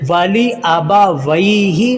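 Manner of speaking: वाली आबा वही ही slowly, li short, 'way' a glide; correct careful recitation. Shown as a demonstration. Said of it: "ये मजहूल हो जाएगा". (वाली आबा वही ही धीरे, साफ़ पढ़ें)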